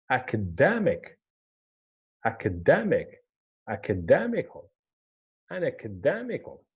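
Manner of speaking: "Academic, academical" punched on DEM, then falling; penultimate stress. Shown as a demonstration. Teacher demonstrating stress on the one before the last, DEM stressed.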